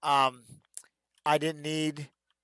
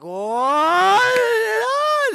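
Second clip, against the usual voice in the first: high, loud rising cheer; crowd roar; 10 dB louder than usual. Retold or imitated. Crowd igniting as the fight ends, big mock roar. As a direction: erupt like a whole arena.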